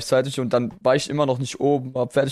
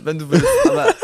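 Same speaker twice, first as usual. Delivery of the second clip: laughing hard; 3 dB louder than usual. Real, not acted. Laughing during pronunciation fight.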